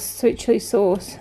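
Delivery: ordinary talk, nothing marked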